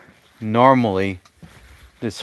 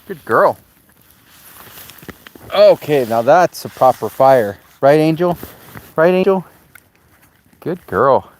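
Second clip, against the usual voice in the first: higher, louder happy chatter to the dog; cheery dog talk; 4 semitones higher, 6 dB louder than usual. Real, not acted.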